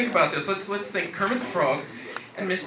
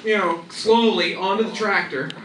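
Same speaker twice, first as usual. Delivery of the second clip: usual speaking voice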